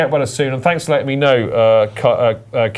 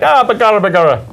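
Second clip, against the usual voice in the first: higher-pitched stage Irish exclamation; mock Irish accent; 4 semitones higher, 4 dB louder than usual. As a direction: cry out in a hammed-up Irish brogue. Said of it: "It's my Irish accent".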